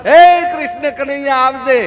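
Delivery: loud, high cry to Krishna; calling out. Retold or imitated. Draupadi calls Krishna from the Delhi court, loud calling out. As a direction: call out high and loud, as Draupadi summoning help. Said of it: "Draupadi આમ હાકલો માર્યો".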